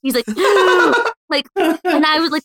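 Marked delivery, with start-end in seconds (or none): 0.3-1.2 sharp, exaggerated intake of breath; gasp of revival. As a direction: gasp like someone back from the dead. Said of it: "resurrected from the dead".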